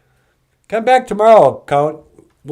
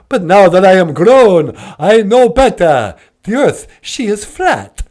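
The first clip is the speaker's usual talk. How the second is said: indignant, high, swooping vampire voice; hammed-up Transylvanian declaiming after a cackle; Count Von Count; 5 semitones higher, 4 dB louder than usual. Count insisting to the host the earth is flat, indignant vampire voice.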